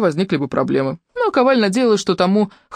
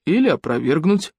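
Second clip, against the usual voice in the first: a different, lower man's voice, dry; husband's deep voice. A narrator's reading. Elena's husband cuts in, dry low voice. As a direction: drop in a flat correction. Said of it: "подал голос еленский муж".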